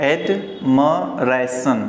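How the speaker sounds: एडमरेशन slowly, "ad-ma-ray-shun" with a short ma; slow model pronunciation. Shown as a demonstration. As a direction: spell out एडमरेशन syllable by syllable, slowly. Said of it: "म पढ़ना है". (एडमरेशन धीरे-धीरे, "म" पर ज़ोर)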